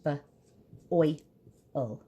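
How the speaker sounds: "B, oi, ul" with a long pause after each sound. Shown as a demonstration. Teacher spelling boil for children, each sound spaced apart. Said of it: "I hear it in the middle".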